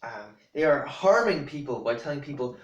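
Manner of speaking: usual speaking voice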